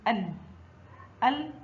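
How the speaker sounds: ال closed on a clear l; letter with sukun. Shown as a demonstration. Teacher shows lam with sukun, ال as a closed syllable. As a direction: say ال as one isolated syllable, no vowel after ل. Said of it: "حرف اللام فوقه سكون ينطق".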